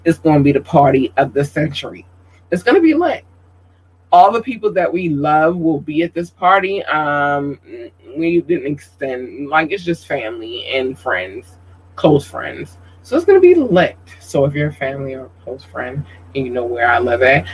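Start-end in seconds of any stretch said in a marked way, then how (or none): 2.5-3.2 high, hyped-up squeal; excited. Real, not acted. Hyping up her party. 13.0-14.0 high, loud hyped-up squeal; excited. Real, not acted. Hyping up her party.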